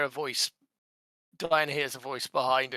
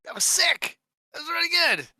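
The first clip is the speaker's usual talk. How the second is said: loud, high-pitched cheer; excited shouting; 7 dB louder than usual. Acted. Acting Dewey cheering after reappearing.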